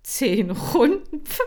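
high, loud, slow incredulous echo; scoffing disbelief. Acted. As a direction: repeat it as if it's a joke. Hamster staunt über Kommando. (ungläubig hoch und laut nachgefragt)

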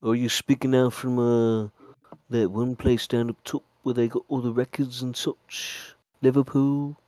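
put-on northern British accent. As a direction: have a go at a Liverpool accent. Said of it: "What does your British accent sound like".

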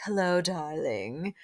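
a hint of a slow, sultry socialite purr; Luann's voice. Host lightly imitating a socialite greeting in the dark, slow purr. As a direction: lightly purr it, drawn out and knowing. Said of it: "hear Luann's voice being like".

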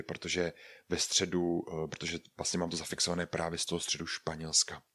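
ordinary talk, nothing marked

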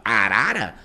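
"Arara" stretched out to show the doubled syllable; slow, emphatic "Arara", leaning on the repetition; repeated syllable stressed. Shown as a demonstration. Teacher repeating the Tupi example, "Arara" slow and emphatic. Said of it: "da última sílaba".

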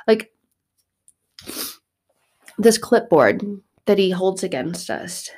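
ordinary talk, nothing marked

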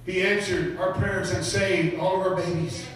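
high, loud, building preacher cry; preaching at full force. Acted. Preacher proclaiming the priests' conversion to the congregation, at full force. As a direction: preach it out to the crowd.

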